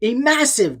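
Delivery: plain reading voice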